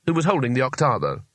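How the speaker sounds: plain reading voice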